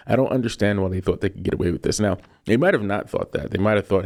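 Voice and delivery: usual speaking voice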